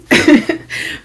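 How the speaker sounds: breathy high giggle; airy amused chuckle; laugh. Real, not acted. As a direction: let a helpless laugh out between words. Laughs amid telling.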